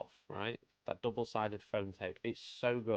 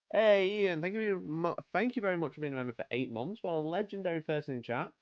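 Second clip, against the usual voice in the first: excited greeting; 9 semitones higher, 5 dB louder than usual. Real, not acted. Loud greeting to a subscriber.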